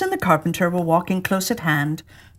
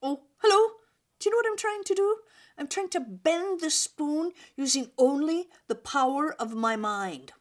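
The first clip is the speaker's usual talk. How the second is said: soft high voice; 7 semitones higher, 6 dB quieter than usual. Acted. New character, soft high voice.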